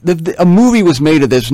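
ordinary talk

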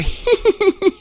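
very high, tittering giggle; a squeaky little high-pitched giggle. Shown as a demonstration. Preacher imitating another kind of Japanese laugh, high giggle. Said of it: "Some of them laugh".

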